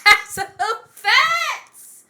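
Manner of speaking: laughing through the words, high and loud; real laughter breaking up the line. Real, not acted. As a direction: burst out laughing mid-sentence. Cracking up at co-host's remark.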